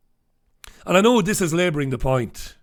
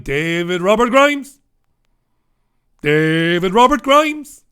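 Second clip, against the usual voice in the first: slow, louder, each name drawn out with scorn; 6 dB louder, slower than usual. Real, not acted. Host naming the man he despises, slow and loud. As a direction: spell out David Robert Grimes slowly and loudly.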